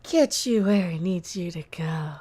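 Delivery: slow, whispered car slogan; creepy ad whisper. Acted. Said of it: "and it's so creepy".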